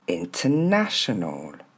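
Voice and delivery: INTERNATIONAL as "in-te-NA-she-nol", slowly; "international" with silent R and "ol" ending; model pronunciation. Shown as a demonstration. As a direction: put it back together, stress on NA. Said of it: "Plus lentement".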